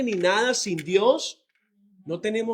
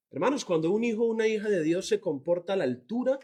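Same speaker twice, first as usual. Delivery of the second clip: ordinary talk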